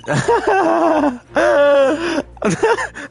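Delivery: long high cackle; laughing fit. Real, not acted. Streamer can't finish the line, long high laughing fit. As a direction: lose it laughing mid-sentence. Cracks up mid-line while reading.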